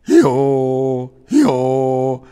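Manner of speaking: soft sung hee-haw bray; donkey bray. Acted. Donkey proving her good voice, soft bray. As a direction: bray a couple of notes. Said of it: "She sang a few notes".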